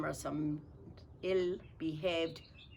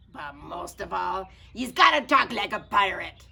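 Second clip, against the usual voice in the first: loud pirate captain; 2 semitones higher, 8 dB louder than usual. Acted. Pete declares rules.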